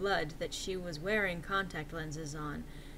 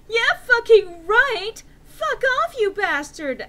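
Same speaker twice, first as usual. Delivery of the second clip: very high loud furious scream; screaming insults over the top; hammy stage actor; 14 semitones higher, 12 dB louder, slower than usual. Acted. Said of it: "I screamed".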